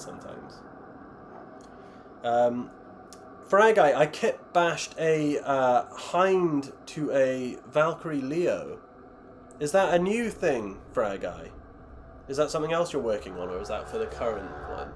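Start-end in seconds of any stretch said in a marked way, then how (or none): none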